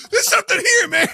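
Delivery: very high, loud mock panic; hammed-up panicked shout; spooked bystander. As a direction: yell it as a frantic warning. Acting out spooked people again.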